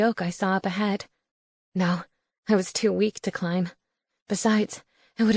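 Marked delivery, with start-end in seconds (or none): none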